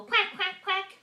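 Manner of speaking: short, high duck quacks; high-pitched duck imitation. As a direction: quack brightly in a quick row. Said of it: "What do the duckies say?".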